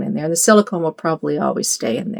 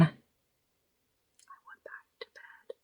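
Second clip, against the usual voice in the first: whispered; 24 dB quieter, much slower than usual. Real, not acted. Confiding aside, sleepy.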